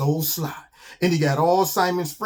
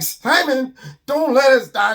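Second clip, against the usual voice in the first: panicked plea; 7 semitones higher, 5 dB louder than usual. Acted. Animals hanging over the cliff.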